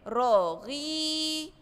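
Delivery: رغيف with the ee held long and slow; drawn-out long vowel. Shown as a demonstration. Teacher drawing out the vowel for first graders, slow. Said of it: "يبقى كلمة".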